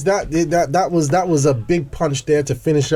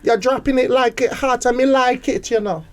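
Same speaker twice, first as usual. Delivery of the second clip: higher-pitched put-on Jamaican patois; Jamaican accent; 5 semitones higher than usual. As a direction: improvise playful Jamaican patter. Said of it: "I wanna hear your Jamaican".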